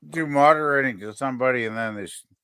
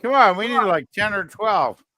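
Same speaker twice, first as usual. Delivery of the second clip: higher, louder playful yelling; mock scolding shout; 6 semitones higher, 6 dB louder than usual. Real, not acted. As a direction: bark at the lazy viewers. Yelling at viewers for likes.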